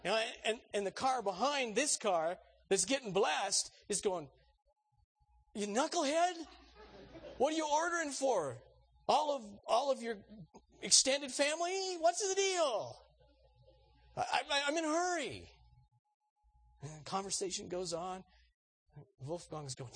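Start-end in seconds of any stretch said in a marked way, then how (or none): 5.5-15.5 high, loud exasperated yell; impatient driver. Acted. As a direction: holler in mock outrage at the car ahead. Impatient driver in line behind.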